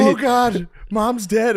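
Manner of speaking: hammed-up panic; mock panicked sister. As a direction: shriek it like a frantic sister. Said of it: "Katie's like".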